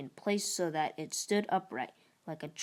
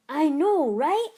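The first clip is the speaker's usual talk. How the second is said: higher, louder sarcastic "I know, right?"; amused agreement; 10 semitones higher, 9 dB louder than usual. Acted. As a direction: roll your eyes and agree. Sheila mocking the name Bicep.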